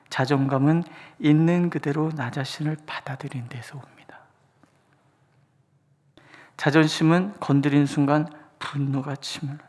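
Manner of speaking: ordinary delivery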